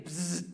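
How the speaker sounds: short "bzzz" buzzing noise; imitated insect buzz. Storyteller hearing it again in the shower, imitated buzz.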